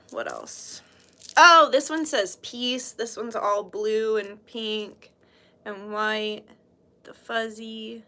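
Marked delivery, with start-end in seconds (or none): 0.1-0.8 whisper. Real, not acted. Muttering to herself while browsing. 7.0-8.0 whisper. Real, not acted. Aside while showing items.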